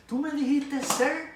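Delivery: the drill instructor loses it completely; furious scream. Retold or imitated. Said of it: "esa mujer se volvió loca". (grito furioso, mucho más agudo y fuerte)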